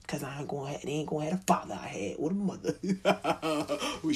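ordinary talk, nothing marked

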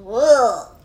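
drawn-out yuck noise; disgusted ugh. Acted. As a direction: groan of revulsion. Disgust at smelly sock.

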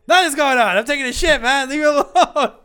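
high, loud shout back; yelling through door. Retold or imitated. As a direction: yell it from the stall. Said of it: "I was like".

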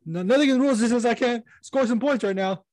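high, loud smug player arguing with the ref; mock defiant player. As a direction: argue your case to the ref, smugly. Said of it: "I'm like".

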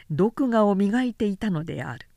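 usual speaking voice

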